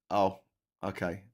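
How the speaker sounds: usual speaking voice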